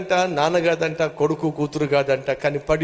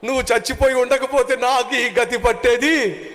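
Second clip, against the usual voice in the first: mock wailing; 9 semitones higher than usual. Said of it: "వచ్చి అక్కడ ఏడుస్తూ".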